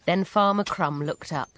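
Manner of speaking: plain reading voice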